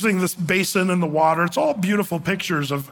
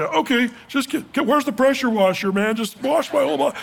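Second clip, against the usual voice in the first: eager rush; 7 semitones higher than usual. Retold or imitated. Peter flips to eager demand.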